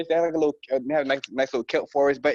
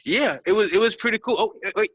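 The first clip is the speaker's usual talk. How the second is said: usual speaking voice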